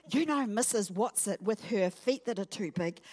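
ordinary talk, nothing marked

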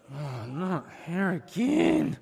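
slow, drawn-out grumble of dread; half-joking weary groan; mock dismay. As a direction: drag it out like it's a chore. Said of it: "and I'll be like".

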